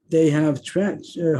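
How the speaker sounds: ordinary delivery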